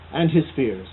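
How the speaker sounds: usual speaking voice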